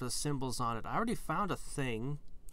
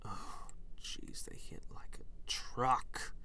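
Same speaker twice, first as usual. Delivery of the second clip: whisper; 6 dB quieter, slower than usual. Real, not acted. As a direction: whisper it, stunned. Whispers after getting hit.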